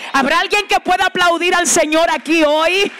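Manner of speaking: usual speaking voice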